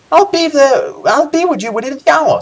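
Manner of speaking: very high-pitched character voice, rather loud; Baindan's voice. Acted. Game reader voicing guildmaster answering the summons, high put-on voice. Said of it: "says the voice of Baindan".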